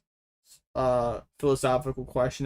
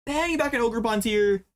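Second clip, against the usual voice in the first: excited cheer; 7 semitones higher than usual. Real, not acted. Big win celebration.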